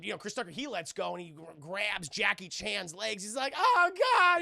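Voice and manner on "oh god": high, loud panicked cry; hammed-up wail while dangling; mock terror. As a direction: cry out as if about to fall. Voices Tucker hanging from legs.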